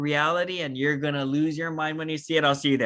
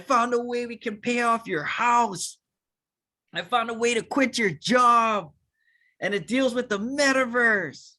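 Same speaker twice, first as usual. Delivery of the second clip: excited pitch; 7 semitones higher than usual. Acted. Acted excited pitch continues.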